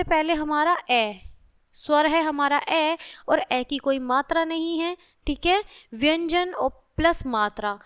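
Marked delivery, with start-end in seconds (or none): none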